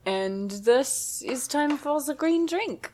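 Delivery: put-on slow, halting French accent; terrible French accent. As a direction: play a tourist with a thick French accent. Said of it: "that's my terrible French accent".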